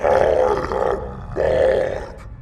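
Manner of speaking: very slow, low, loud creature voice; a skeleton groaning its name. A narrator's reading. The skeleton introducing itself to Helen, low and slow. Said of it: "said the skeleton".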